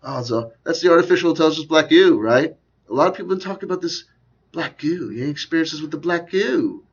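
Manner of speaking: high-pitched interviewer impersonation; Miles Johnson. Acted. Host mimicking an interviewer prompting young guests, high put-on voice. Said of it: "he's there".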